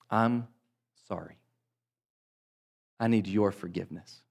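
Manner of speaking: quiet, heartfelt sorry; sincere apology. Shown as a demonstration. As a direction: say it like you truly mean it. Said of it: "That's not an apology".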